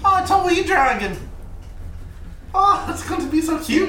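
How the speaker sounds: very high-pitched gushing; high cutesy aww voice; cooing delight. Real, not acted. Player gushes over small dragon.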